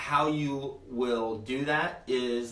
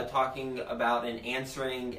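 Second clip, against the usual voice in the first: ordinary talk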